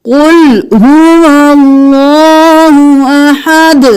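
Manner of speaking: اللہ said thick and full-mouthed, slowly; heavy Allah. Shown as a demonstration. Teacher showing the heavy Allah in Al-Ikhlas. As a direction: keep the lam in اللہ heavy. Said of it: "اللہ کا لفظ موٹا ہے".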